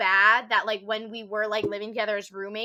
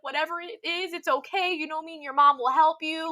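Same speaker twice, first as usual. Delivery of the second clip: reassuring dad; 6 semitones higher than usual. Retold or imitated. Dad comforting her crying daughter.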